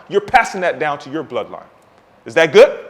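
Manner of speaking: normal talking voice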